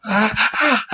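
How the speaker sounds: high startled cries; frightened gasps. Acted. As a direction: gasp in terror at the doorway. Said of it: "look to the doorway and go".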